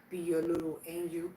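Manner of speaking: ordinary talk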